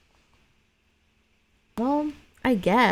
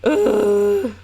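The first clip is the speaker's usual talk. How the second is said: disgusted groan; 11 semitones higher, 7 dB louder than usual. Real, not acted. Disgust at scary scene.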